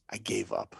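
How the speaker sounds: hushed whispered confession. Real, not acted. Telling listeners the secret of how he coped, whispered. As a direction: drop to a whisper for the big reveal.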